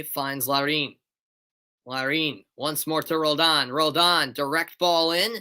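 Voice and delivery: brisk, lifted match call; play-by-play. Acted. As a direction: call the free kick live.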